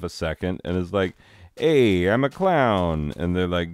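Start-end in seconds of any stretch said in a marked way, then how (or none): 1.6-3.1 loud, raised, drawn-out clown greeting; cheery clown. Retold or imitated. Clown shows up at court, hammed-up cheery hello. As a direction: announce yourself big and slow like a clown. Clown turns up announcing himself.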